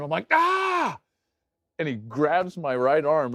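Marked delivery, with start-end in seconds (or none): 0.3-1.0 high, loud scream of pain; re-enacted injury scream, pitched way up; retold scream. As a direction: cry out in acted agony. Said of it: "and I'm screaming".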